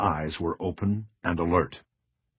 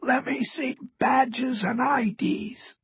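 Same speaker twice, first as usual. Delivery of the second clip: slow rasping demand; raspy; slower than usual. A narrator's reading. Cross demands proof from the agent, raspy. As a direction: demand it in a strained rasp. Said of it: "Cross rasped".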